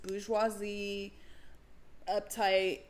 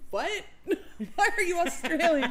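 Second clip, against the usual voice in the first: high, loud, laughing mock bafflement; laughing disbelief; 9 semitones higher, 7 dB louder than usual. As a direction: crack up asking in disbelief. Retold baffled reaction, laughing.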